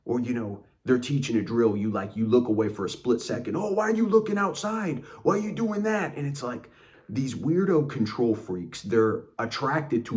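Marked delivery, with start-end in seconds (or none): 3.6-6.2 very high-pitched mock outrage; mock flipping out. Coach sees you look away for a second, hammed-up flip-out. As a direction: imitate a coach fussing over nothing. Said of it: "they'll flip out on you".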